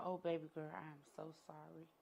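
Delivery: soft, fading consolation; tender comfort. Retold or imitated. Mother to her hurt daughter, a soft tender apology. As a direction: hold her gently with the words.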